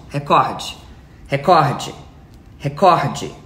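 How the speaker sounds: recorde repeated, stress on cór; drilled pronunciation. Shown as a demonstration. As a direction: say recorde with the second syllable stressed. (recorde repetido, tônica no cór)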